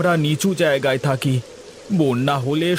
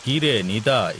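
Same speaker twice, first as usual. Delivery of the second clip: lower, heavier landlord voice; zamindar voice; 5 semitones lower than usual. Acted. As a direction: speak down as the village landlord. Same actor voices the zamindar.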